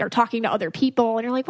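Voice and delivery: ordinary talk, nothing marked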